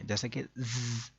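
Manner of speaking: ز hissed slow and soft like a whistle; whistling z. Shown as a demonstration. Teacher shows the whistling letters, slow hissed ز. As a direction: let ز whistle out, slowly. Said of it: "سٹی کی طرح کی تیز آواز".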